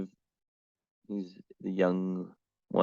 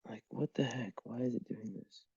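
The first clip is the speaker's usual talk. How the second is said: frustrated whisper; 13 dB quieter than usual. Real, not acted. Camera keeps failing during stream.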